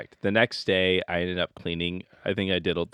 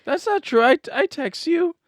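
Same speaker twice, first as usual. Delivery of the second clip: very high, louder objection; high-pitched protest; 6 dB louder than usual. Real, not acted. Husband denying he never checks in, high-pitched protest.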